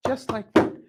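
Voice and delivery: ordinary delivery, nothing marked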